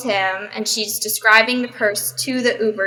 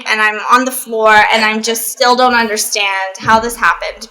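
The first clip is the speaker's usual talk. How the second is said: normal talking voice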